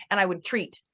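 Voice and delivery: ordinary talk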